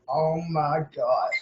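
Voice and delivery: low, slow, hushed disbelief; retold awe. Fan retelling his reaction to the gauntlet win, hushed awe. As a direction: draw it out softly, as if stunned.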